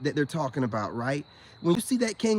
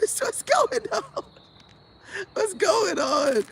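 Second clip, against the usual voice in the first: high, slow laughing question; cracking up while asking; laughing disbelief; 12 semitones higher, slower than usual. Real, not acted. Reacting to the kangaroo clip, laughing high.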